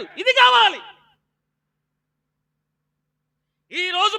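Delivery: high, loud shouted sermon; full-force pulpit shout; fiery preaching. Acted. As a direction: drive it home to the church.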